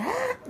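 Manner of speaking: high mock gasp of a starstruck fan; fangirl gasp. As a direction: imitate an overexcited fan's squeal. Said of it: "do that fangirl, fanboy".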